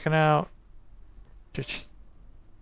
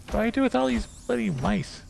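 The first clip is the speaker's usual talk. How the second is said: panicked; 10 semitones higher than usual. Real, not acted. Panicking at mice.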